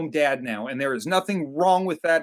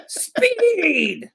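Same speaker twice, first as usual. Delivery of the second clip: high, stretched mock shout of "Speed!"; Mickey shout; 14 semitones higher than usual. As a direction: squeal out "Speed!" like a trainer. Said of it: "and go".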